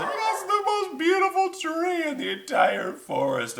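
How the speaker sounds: very high, squeaky creature voice; high-pitched cartoon critters; little animal voices. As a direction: squeak it like a tiny forest animal. Animals all speak in story.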